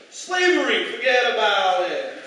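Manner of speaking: loud, high Staten Island wiseguy; Staten Island Lincoln. Pretend. Comic imagining Lincoln from Staten Island, loud New York accent. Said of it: "Abraham Lincoln if he was from Staten Island".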